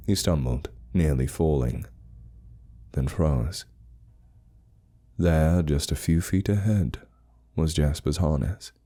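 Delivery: ordinary delivery, nothing marked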